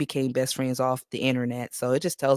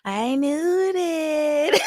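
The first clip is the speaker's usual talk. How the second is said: very slow, high, cry-laughing I knew that; high laughing wail; laughing hard; 10 semitones higher, much slower than usual. Real, not acted. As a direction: stretch it out through a crying laugh.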